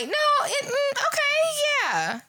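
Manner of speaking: high, fake-nice brush-off; smooth it over politely. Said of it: "I was just like".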